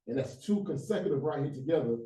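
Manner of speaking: ordinary talk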